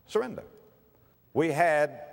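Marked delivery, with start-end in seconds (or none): 0.1-0.4 higher echo. Real, not acted.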